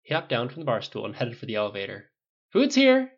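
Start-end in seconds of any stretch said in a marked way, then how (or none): 2.5-3.1 high, loud call over the shoulder. A narrator's reading. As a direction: call out loudly to someone across the room. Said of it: "he called over his shoulder".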